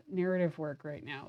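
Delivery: usual speaking voice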